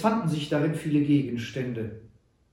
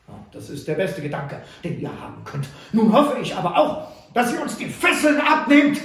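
building to a louder, higher shout; demanding shout; 9 semitones higher, 8 dB louder than usual. A narrator's reading. Butler demands the captors remove his bonds, shouting. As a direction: insist loudly on being untied.

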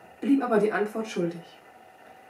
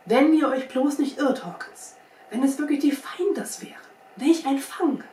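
higher, agitated outburst; keyed-up, raised voice; excited exclamation; 5 semitones higher, 4 dB louder than usual. A narrator's reading. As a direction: call it out, thrilled at the catch. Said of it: "sprang er erregt auf und rief".

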